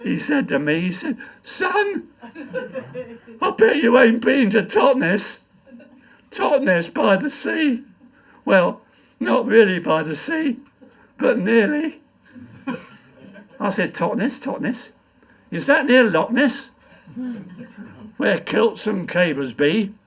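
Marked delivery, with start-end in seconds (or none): 1.4-7.8 put-on high, slow, teasing old man; a lightly voiced old stranger with a taunt; dying man's boast. Dying man speaks in poem. 8.4-19.9 poem recitation. Acted. Recites his comic poem.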